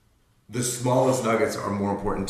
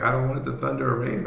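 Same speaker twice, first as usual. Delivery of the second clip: scared daughter; 6 semitones higher, faster than usual. Retold or imitated. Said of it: "she starts crying and she was like".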